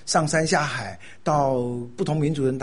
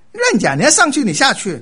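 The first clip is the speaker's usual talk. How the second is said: scolding sister; 11 semitones higher, 8 dB louder than usual. Retold or imitated. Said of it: "她说".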